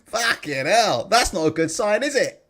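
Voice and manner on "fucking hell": ordinary delivery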